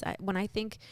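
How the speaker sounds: usual speaking voice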